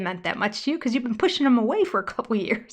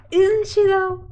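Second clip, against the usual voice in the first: high, loud and incredulous; 10 semitones higher, 8 dB louder than usual. Real, not acted. Calling Robin spoiled to viewers, high and loud. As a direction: throw it out loud, daring anyone to disagree.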